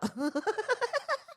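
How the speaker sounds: high-pitched burst of laughter; real high giggling laugh; amused laugh. Real, not acted. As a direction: laugh helplessly, climbing high. Amused by alpaca clip.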